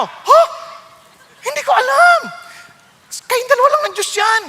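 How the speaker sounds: high, loud startled denial; surprised protest. Retold or imitated. The pastor, startled by the gossip charge, high and loud. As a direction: answer in disbelief at the accusation.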